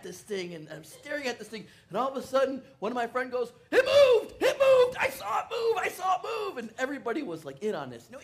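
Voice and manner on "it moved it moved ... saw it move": very high, loud yell; excited shout. Retold or imitated. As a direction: scream it like you saw a miracle. Friend shouts glass moved.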